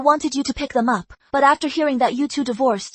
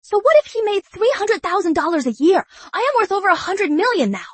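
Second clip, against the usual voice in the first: high, haughty boasting; arrogant; 6 semitones higher than usual. Acted. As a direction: rich daughter sneering at her ex-husband. Daughter boasting, scorning ex.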